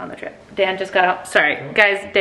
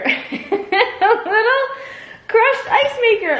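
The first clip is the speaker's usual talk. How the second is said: much higher and louder, slow and laughing; delighted squeal; 5 dB louder, much slower than usual. Real, not acted. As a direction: squeal with joy over the gift. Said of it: "I'm thrilled".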